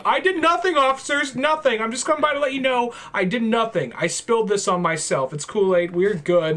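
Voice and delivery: high-pitched, over-innocent pleading; panicky protest. Acted. Bloodied kid explaining himself to police officers, panicky protest. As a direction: protest innocence too eagerly, starting high and easing down.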